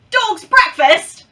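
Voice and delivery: high, loud, slow mock Aussie; failed Australian accent. Shown as a demonstration. Vlogger botching a looked-up phrase, hammed-up bad Australian accent. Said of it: "it's totally not Australian".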